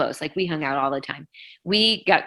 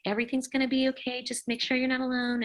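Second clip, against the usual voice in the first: reassuring; 8 semitones higher, 4 dB quieter than usual. Retold or imitated. Comforting the fiance on phone.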